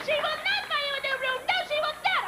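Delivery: very high mock shriek; high-pitched hammed-up refusal; screaming sister. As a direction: imitate a mother yelling from afar. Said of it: "I hear my sister screaming".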